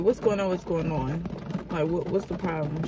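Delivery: low, soft insistent asking; quiet questioning. Retold or imitated. Asking a coworker privately what's wrong, soft and low. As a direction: press her gently but keep it down.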